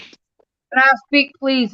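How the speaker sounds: drawn out, insistent plea to be heard; asking again after being talked over; slow insistent repeat. Real, not acted. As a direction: stretch each word, pressing the point. Repeats request, ignored before.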